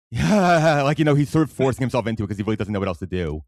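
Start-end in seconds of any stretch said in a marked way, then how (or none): 0.0-0.8 slow, drawn-out forced laugh, louder and higher; a half-hearted mock manic laugh, much slower. Shown as a demonstration. A fan acts out Luthen's forced laugh, slow and laughing.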